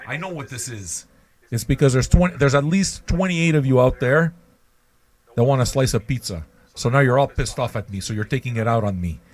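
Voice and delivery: ordinary talk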